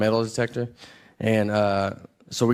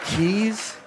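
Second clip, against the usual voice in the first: incredulous echo; 7 semitones higher than usual. Retold or imitated. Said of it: "Keys? Do you think?".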